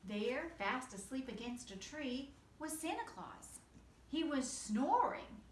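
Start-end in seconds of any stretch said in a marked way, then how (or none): none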